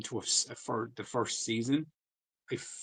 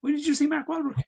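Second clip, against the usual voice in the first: squeaky shocked question; high surprised squeak; 6 dB louder than usual. Real, not acted. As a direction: jump up into a squeak.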